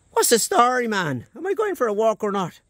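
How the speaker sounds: squeaky Irish dog begging for a walk; Irish doggy voice. Pretend. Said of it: "I am an Irish doggy".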